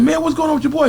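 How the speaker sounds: high incredulous question; Debo's voice. Retold or imitated. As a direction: ask it high and amazed. Debo asking Hammer.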